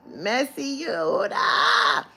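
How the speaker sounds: high, loud, slow call of "Yehuda"; drawn-out call. Real, not acted. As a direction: stretch "Yehuda" into a long teasing shout. Calling out to Yehuda.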